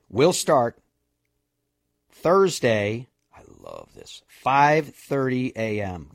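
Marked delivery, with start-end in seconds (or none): 3.4-4.2 muttered aside. Real, not acted.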